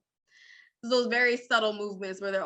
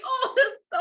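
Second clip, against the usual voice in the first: high laugh with a catch; overcome, laughing high; laughing, touched; 13 semitones higher than usual. Real, not acted. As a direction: swoon through laughter. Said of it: "My little heart was like".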